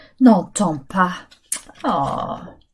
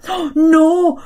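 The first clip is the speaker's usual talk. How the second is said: ordinary talk, nothing marked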